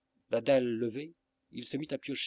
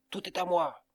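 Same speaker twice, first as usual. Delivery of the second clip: higher and louder, a furious howl of "Tout est à moi!"; 7 semitones higher, 5 dB louder than usual. A narrator's reading. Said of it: "il hurla".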